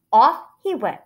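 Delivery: ordinary delivery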